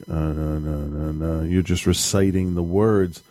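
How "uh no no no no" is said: flat monotone. Retold or imitated. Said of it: "you're kinda like".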